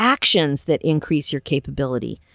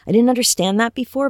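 higher and faster, pressing the point home; emphatic rush; 6 semitones higher, faster than usual. Real, not acted. Coach to listeners, realisation repeated in a higher, faster rush. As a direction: blurt the realisation out quickly.

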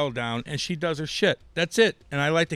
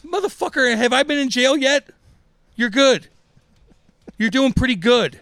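mock self-congratulation; 12 semitones higher, 9 dB louder than usual. Mock self-praise about road rage.

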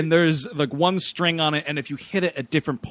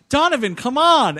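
calling out; 7 semitones higher, 5 dB louder than usual. Retold or imitated. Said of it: "he's like".

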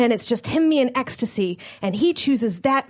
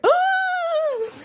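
dismayed realization. Retold or imitated. Realizing he must stay.

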